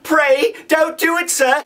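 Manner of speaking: put-on high, frightened child's plea; pleading in terror. A narrator's reading. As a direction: beg for your life, voice up high. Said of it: "I pleaded in terror".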